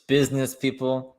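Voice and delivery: ordinary talk, nothing marked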